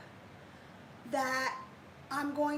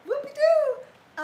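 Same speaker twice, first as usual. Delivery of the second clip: high, loud, slow whoopie-doo; a big sarcastic cheer; mock cheer; 12 semitones higher, 12 dB louder, slower than usual. Real, not acted. Mock cheer about intervals.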